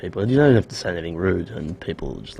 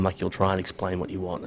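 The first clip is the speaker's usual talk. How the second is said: normal talking voice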